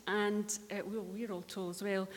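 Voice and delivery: ordinary talk, nothing marked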